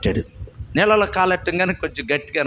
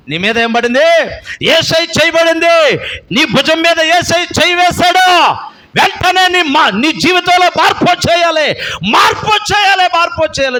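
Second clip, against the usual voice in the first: higher, louder preaching at full force; fiery preaching; 12 semitones higher, 7 dB louder than usual. Real, not acted. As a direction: exhort the congregation with fire. Preaching climax.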